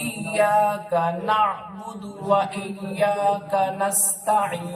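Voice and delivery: very slow, ইয়াকা and নাস্তাইন separate; words kept apart. Shown as a demonstration. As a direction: recite each word distinctly, not কানাস্তাইন. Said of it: "আলাদা আলাদা". (আলাদা আলাদা করে)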